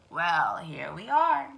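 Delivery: low, slow fox; sly fox. Acted. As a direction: announce the den with relish. Fox arrives at den.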